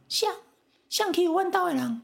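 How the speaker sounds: high, shrill-pitched Taiwanese granny yelling out; a granny charging out to defend her family, voice way up high; angry grandma. Retold or imitated. Grandma scolding whoever bullied her granddaughters, very high pitched. Grandma rushes out hearing crying.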